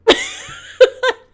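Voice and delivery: high loud real laugh; burst of laughter. Real, not acted. Laughing at her own joke.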